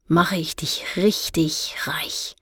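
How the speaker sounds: whispered promise. Acted. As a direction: whisper it like a secret deal. Kalavesi lowers voice, tempting offer.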